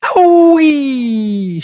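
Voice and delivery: very high, long drawn-out shriek; high scream. A narrator's reading. Grandma screams as the second dose hits her. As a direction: let out a long piercing shriek. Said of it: "she screamed".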